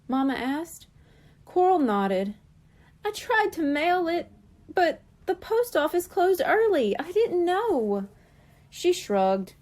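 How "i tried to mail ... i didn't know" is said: Coral's voice. A narrator's reading. Coral explaining upset to Mama.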